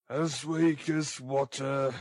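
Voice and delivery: slow, lightly voiced surly robber; a hint of an ill ruffian; sick robber's voice. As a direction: drag it out as an ailing ruffian. Narrator voices the ill Sikes.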